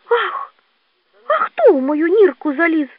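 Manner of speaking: high indignant little badger; angry cry. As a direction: squeal crossly at an intruder. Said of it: "сердито закричав борсучок". (сердито, високим голосом)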